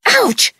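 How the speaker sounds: high sharp cry of pain; ouch. Real, not acted. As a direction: snap out in pain. Pricked by burrs.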